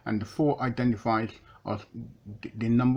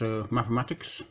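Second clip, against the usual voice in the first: ordinary talk, nothing marked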